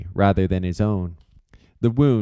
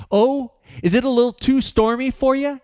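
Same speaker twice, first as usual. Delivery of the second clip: higher, mocking jab; sardonic snap. Aiden mocking George's fear of storms, sardonic. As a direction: sneer the question back at him. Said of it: "Aiden snapped back sardonically".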